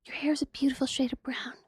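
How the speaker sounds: soft, breathy whisper with a tiny creepy tone; the peanut hisses a compliment in a whisper. Retold or imitated. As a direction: whisper it like a secret admirer. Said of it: "Your little creepy voice is ridiculous".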